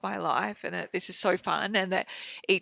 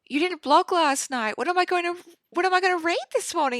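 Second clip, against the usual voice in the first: higher, fast mock whine from a colleague; imitating a coworker; 9 semitones higher, 4 dB louder than usual. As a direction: play the disappointed reader, pitched up. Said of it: "she was like".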